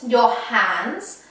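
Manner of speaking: usual speaking voice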